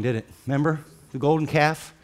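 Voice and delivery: ordinary talk, nothing marked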